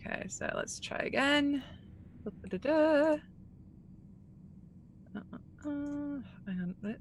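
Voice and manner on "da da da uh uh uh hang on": ordinary delivery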